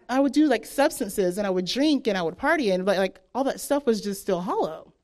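normal talking voice

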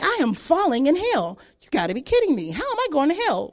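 mock outraged disbelief, swinging high; incredulous. Indignant disbelief while falling, retold.

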